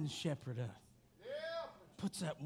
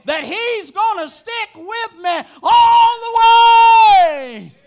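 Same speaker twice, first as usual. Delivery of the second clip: loud, high preacher's shout, drawn out at the peaks; full-force preaching; 11 semitones higher, 18 dB louder, slower than usual. Real, not acted. Preacher telling the flock they belong to him, full-force preaching. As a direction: build from urgent preaching to a held-out shout.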